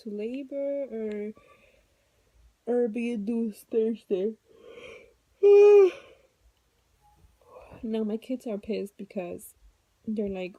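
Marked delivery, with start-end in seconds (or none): 5.4-6.0 sigh. Real, not acted. Exhausted pregnant mother venting.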